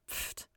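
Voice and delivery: a soft dismissive breath of air; quiet scoffing pfft. A narrator's reading. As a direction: blow out a little scoff. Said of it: "Linda scoffed".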